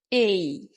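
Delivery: এই as a long gliding "ey"; corrected pronunciation. Shown as a demonstration. Teacher lists the letters whose sound changes, corrected pronunciation. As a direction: draw এই out into a falling diphthong. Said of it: "এই কয়েকটার উচ্চারণের মধ্যে শুধু পরিবর্তন হবে".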